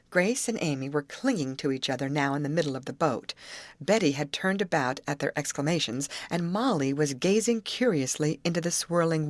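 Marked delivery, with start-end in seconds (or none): none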